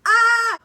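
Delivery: very loud, high, sustained squeal; reenacted excited scream. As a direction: scream like an overjoyed fan, replayed. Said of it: "I was like".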